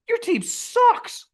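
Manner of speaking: very slow, high, stretched "sucks"; slow high-pitched sneer; drawn-out taunt. Retold or imitated. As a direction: drag each word out high and mocking. Mocking angry owner again.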